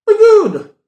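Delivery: be good squeaked very high and loud; mock Roman emperor, voice leaping up; Marcus Aurelius voice. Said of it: "That's how Marcus Aurelius sounded".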